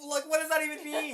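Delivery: laughing, incredulous and loud; laughing disbelief. Real, not acted. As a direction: laugh through the question.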